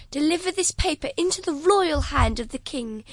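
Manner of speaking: ordinary delivery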